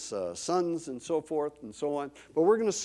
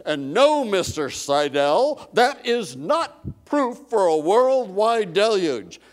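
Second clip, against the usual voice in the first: imitating the professor; 5 semitones higher, 10 dB louder, slower than usual. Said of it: "and she says".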